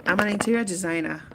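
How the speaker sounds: usual speaking voice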